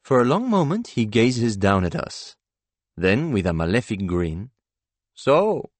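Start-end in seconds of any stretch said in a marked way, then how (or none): 5.1-5.7 high, loud gloating "So"; villain's gloating exclaim. A narrator's reading. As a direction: savour it like a cruel tormentor. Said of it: "he exclaims".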